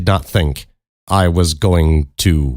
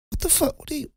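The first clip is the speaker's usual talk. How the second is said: tiny high squeak of disbelief; very high and soft, barely getting it out; stunned disbelief; 13 dB quieter, faster than usual. Retold or imitated. Teller re-enacts hearing her story, squeaking in disbelief.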